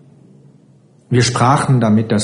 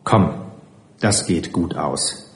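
put-on gently calm a frightened child; beruhigend; 5 semitones lower, 7 dB quieter than usual. A narrator's reading. Narrator reassures the frightened child. (leise und ruhig tröstend, tiefer)

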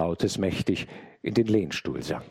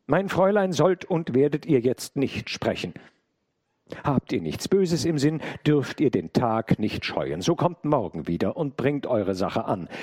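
raised, resolute voice; strong and firm; 6 semitones higher than usual. A narrator's reading. Maid bars the intruder from her mistress, strong and firm. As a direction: stand your ground, firm and higher-pitched. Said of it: "sprach stark und fest".

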